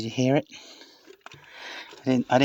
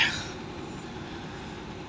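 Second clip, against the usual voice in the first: tired sigh; 14 dB quieter than usual. Real, not acted. Sigh in the hot car.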